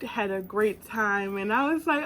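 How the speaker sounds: ordinary talk, nothing marked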